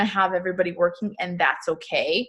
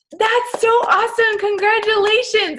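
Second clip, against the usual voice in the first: much higher, louder breathy squeal of delight; squealing friend; 12 semitones higher, 4 dB louder than usual. Retold or imitated. A teammate congratulating her on her goal, squealing excitedly. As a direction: gush it like a thrilled teammate.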